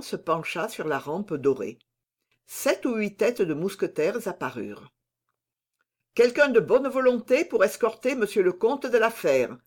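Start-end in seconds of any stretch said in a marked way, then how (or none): 6.1-9.6 raised voice hailing up the stairs; a summons shouted up to the men above; calling out. Acted. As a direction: call out to the musketeers above. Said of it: "cria D'Artagnan".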